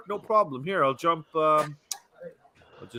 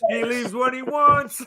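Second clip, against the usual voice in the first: slow, much higher, with a laugh building; slow high amused repeat; 10 semitones higher, slower than usual. Real, not acted. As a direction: echo the tease in a high amused voice. Repeats the tease, pitch higher still.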